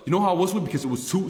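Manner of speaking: ordinary talk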